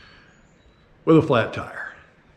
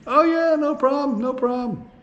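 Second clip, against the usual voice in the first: high, easygoing mock cowboy; breezy cowboy reassurance. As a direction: toss it off like it's nothing. Cowboy mechanic voiced breezily.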